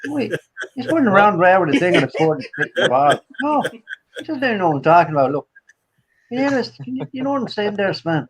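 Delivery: chattering put-on Southern Irish; Southern Irish accent. Bewildered rambling at Sven for laughs, Southern Irish accent. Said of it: "Southern Irish".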